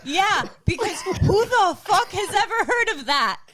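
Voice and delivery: high, loud retold outburst; exasperated disbelief. Wife challenging husband over hippo gift, exasperated. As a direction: protest in comic bewilderment.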